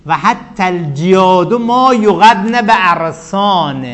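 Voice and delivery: slow, drawn-out verse chant; reciting Arabic verse. Shown as a demonstration. Teacher recites the poet's verse to the class, slow chant. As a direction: intone the poet's line slowly. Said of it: "فی نحو قول امرئ القیس".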